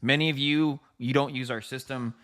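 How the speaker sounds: normal talking voice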